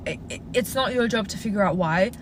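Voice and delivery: ordinary talk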